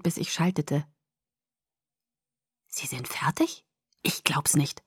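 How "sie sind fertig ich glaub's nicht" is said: breath-only, soft astonishment; awed whisper; hushed disbelief. Acted. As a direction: whisper it as if you can't believe it. Stunned reaction to finished motorbikes.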